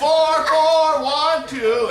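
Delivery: high loud yell, first words slow; boy in a tree shouting his number to the neighbours. Retold or imitated. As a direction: bellow the digits up to the window.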